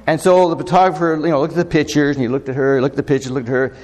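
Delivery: ordinary talk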